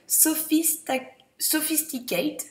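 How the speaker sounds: "Sophisticate" said slowly with a French accent; French-accented English. Real, not acted. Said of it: "moi et mon accent".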